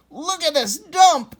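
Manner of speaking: high, loud gruff dog voice; dog growling. A narrator's reading. Dog complains to Al about their dump, growling. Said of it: "Eddie growled".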